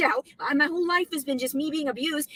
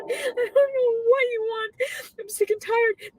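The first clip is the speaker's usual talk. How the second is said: real crying, pleading; crying out in despair; 7 semitones higher, 4 dB louder, slower than usual. Real, not acted. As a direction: voice breaking into sobs, high and slow. Breaking down mid-rant.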